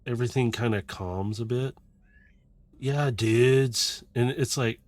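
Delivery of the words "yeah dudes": drawn-out laid-back dude; weird dude voice. Acted. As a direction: drop in as a chilled-out dude. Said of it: "what in the world is this".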